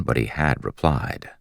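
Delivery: plain reading voice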